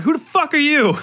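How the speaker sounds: put-on high, loud, tough street-kid voice. Group home kid confronts newcomer, high and loud. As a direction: challenge her like a hardened kid. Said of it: "I'm like".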